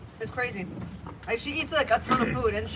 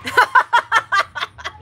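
loud laugh; 7 semitones higher, 9 dB louder than usual. Real, not acted.

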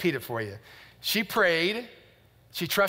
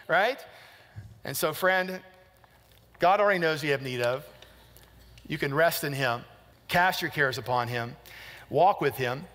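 ordinary talk, nothing marked

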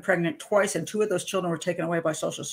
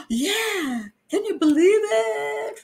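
high, slow, gushing voice; high drawn-out cheerful marvel; chirpy Angela; 12 semitones higher, much slower than usual. Retold or imitated. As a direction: play her bright and oddly pleased. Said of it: "She's like".

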